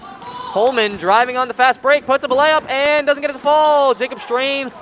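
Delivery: high, loud rapid-fire game call; excited play-by-play. Real, not acted. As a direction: call the fast break live. Live play-by-play of a fast break.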